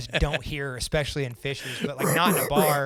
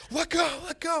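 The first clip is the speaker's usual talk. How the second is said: struggling hothead; 13 semitones higher than usual. Retold or imitated. Trent struggling while held back.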